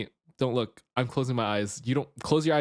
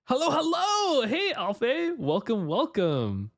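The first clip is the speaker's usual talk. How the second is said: cute anime streamer; 13 semitones higher, slower than usual. Acted. Greeting chat in cute voice.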